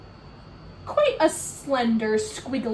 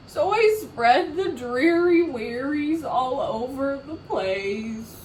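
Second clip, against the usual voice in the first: louder moping chant ending in big blubs; pouty fish refrain; 3 semitones higher, 4 dB louder, slower than usual. Acted. Fish singing his sad refrain to friends, moping. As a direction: mope it out, blub loudly.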